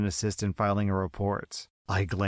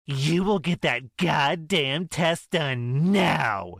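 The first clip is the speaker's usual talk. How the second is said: higher, louder, slow furious yell; yelling mother; 6 semitones higher, 4 dB louder, slower than usual. Acted. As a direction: bellow the command at her daughter. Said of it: "she yells".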